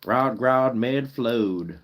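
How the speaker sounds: slow, drawn-out Southern drawl; Southern accent. Shown as a demonstration. Streamer replays the Danish phrase for viewers, Southern accent. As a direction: stretch the vowels like a Southerner. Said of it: "Let's say it with a Southern accent".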